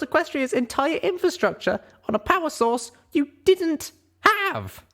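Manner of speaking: a mental breakdown on a very high voice; incredulous outburst. Acted. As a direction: rant in shocked disbelief. Narrator in disbelief, near breakdown.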